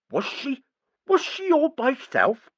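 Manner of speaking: put-on high, slow baby talk. A narrator's reading. Nigel trying baby talk on the child, high. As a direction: talk down to her like to a toddler. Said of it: "trying baby talk".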